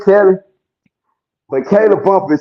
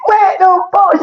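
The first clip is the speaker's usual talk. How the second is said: shocked shout; 12 semitones higher, slower than usual. Retold or imitated. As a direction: yell it like you can't believe your ears. She reacts to the judge.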